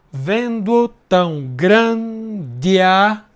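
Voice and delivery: slow, drawn out syllable by syllable; slow scansion. Shown as a demonstration. Teacher scanning the second verse for students, slow and drawn out. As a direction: stretch each syllable while counting. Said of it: "uma outra elisão".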